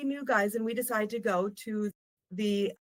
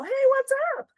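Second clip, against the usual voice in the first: high, bright mock-friendly "hey, what's up" in a put-on voice; friendly greeting; 5 dB louder than usual. Villagers suddenly greeting the strangers, friendly mock voice. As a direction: switch to a sudden buddy-buddy hello, much higher. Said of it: "they're all".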